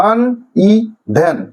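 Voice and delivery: slowly divide uneven into আন, ই, ভেন; uneven with long pauses between syllables. Shown as a demonstration. Teacher repeating the division, uneven slowly broken apart. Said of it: "এই syllabic division গুলো মনে রাখো".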